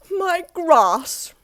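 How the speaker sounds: be scandalised at the very thought; Mister Fussy appalled at Mister Happy's request; prissy horror. Acted. Fussy explains.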